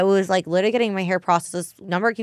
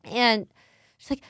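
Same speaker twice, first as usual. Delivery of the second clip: ordinary delivery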